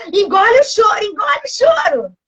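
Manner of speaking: hammed-up maternal command; scolding mother. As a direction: imitate a mother ordering a child to stop crying. Imitates mother scolding her crying child.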